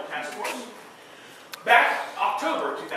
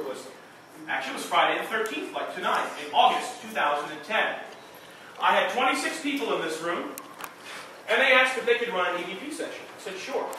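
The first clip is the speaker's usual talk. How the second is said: ghost tour guide; 4 semitones higher, 7 dB louder than usual. Acted. Guide tells ghost story.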